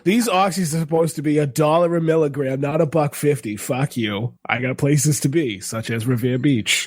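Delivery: put-on Boston street-dealer accent. Haggling over pills at a deal, Boston accent. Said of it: "so good in a Boston accent".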